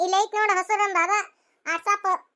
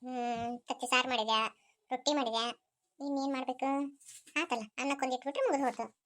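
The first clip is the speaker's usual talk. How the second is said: soft, low musing; talking to herself; 4 semitones lower, 7 dB quieter than usual. Acted. Woman checking the cooking done so far, talking to herself. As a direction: tick off the chores under your breath.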